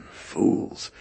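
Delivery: put-on a breathy, hushed Rumeliot-accented cry of scorn; Rumeliot accent exclamation. A narrator's reading. Germanophile scorning the debaters, hushed Rumeliot-accented exclamation. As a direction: spit out one word in a Rumeliot accent, half under the breath. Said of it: "he exclaimed with a Rumeliot accent".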